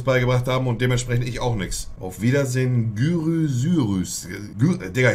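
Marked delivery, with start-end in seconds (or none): 3.0-4.3 GYROSYRUS stretched out slowly as a careful try; labored attempt. Real, not acted. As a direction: sound out Gyrosyrus slowly, unsure of the word. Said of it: "ich versuch's einfach".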